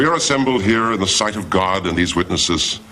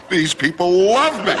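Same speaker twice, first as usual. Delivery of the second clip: delighted; 11 semitones higher than usual. Acted. Preacher basking in applause.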